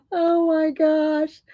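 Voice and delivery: high, giggling through words; laughing. Real, not acted. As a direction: laugh helplessly while talking. Laughing at the giant glue stick.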